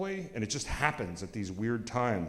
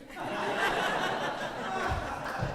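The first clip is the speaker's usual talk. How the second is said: squeaky high laugh; amused high-pitched laugh; 4 dB quieter than usual. Real, not acted. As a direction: burst into a high giggle. Laughs at own joke.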